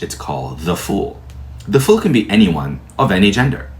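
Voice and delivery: ordinary talk, nothing marked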